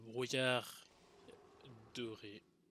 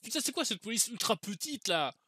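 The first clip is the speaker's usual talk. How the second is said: loud, exasperated swearing at the game; furious outburst at the screen; raging gamer; 6 semitones higher, 8 dB louder than usual. Real, not acted. Raging at a hard boss fight.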